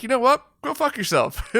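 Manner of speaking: high-pitched mock irritation; bad-mood snap. Creator replaying his reply to a rude comment, mock irritated. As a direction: imitate your own fed-up comeback. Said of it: "I'm in a bad mood".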